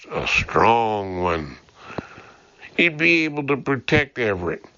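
ordinary delivery

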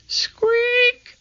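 tiny, very high-pitched squeak; high mousy squeal; mouse squeak. A narrator's reading.

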